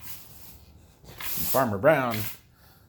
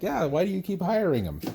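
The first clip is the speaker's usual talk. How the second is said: higher-pitched mock questioning; pointed inner retort; 8 semitones higher than usual. Contractor silently answering complaining farmers, mock questioning. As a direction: toss it back as a raised, incredulous retort. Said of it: "in the back of my mind, I'm like".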